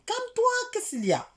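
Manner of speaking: high-pitched exasperated telling-off; acted-out high reprimand; scolding telling-off. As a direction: shoot up high to calm her down. Acting out telling friend off.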